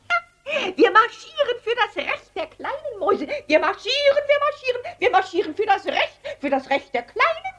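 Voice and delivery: very high squeaky mouse chorus; high, squeaky protest chant; squeaky mice. Acted. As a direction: squeak it out like a crowd of marching mice. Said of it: "piepsten die Mäuse".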